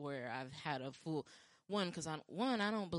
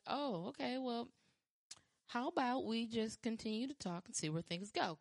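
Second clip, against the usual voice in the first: higher, breezy stalling voice; polite brush-off; 7 semitones higher than usual. Retold or imitated. Replying to a stranger who proposed, breezy and higher. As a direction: humor him sweetly, buy time. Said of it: "I'm just like".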